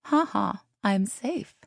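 high, mocking singsong; taunting. A narrator's reading. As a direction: rub it in playfully. Runner taunts after disputed call.

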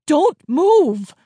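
very loud, high, emphatic command; yell. A narrator's reading. Dad repeating his warning to the children, forceful yell. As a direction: shout it harder, stretching the last word. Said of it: "Dad yelled back".

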